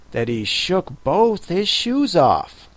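usual speaking voice

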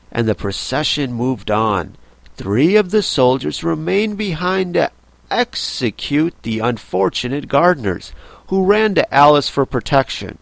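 ordinary delivery, nothing marked

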